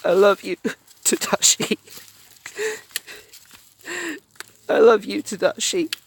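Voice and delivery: high, breathy tearful whisper of love; half-whispered, choked with love; tender whisper. Acted. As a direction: whisper it to him through tears. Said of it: "Honey whispered".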